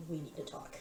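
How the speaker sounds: hushed, close to the ear; soft, secretive aside; whispered. Acted. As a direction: say it very softly, just for one listener. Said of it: "into your ear and I whisper".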